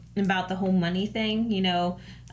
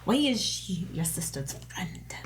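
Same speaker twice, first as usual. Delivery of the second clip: breathy unvoiced whisper; 3 dB quieter than usual. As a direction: whisper it like a secret. Whispered aside.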